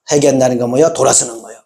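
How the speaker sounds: usual speaking voice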